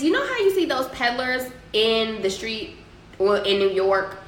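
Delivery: ordinary talk, nothing marked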